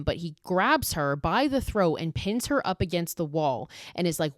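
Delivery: ordinary delivery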